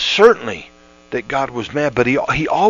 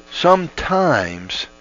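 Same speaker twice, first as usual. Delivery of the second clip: drawn-out slow sometimes, higher; slow repeat; 4 semitones higher, much slower than usual. Shown as a demonstration. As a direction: stretch sometimes out slowly for weight. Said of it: "You know, sometimes".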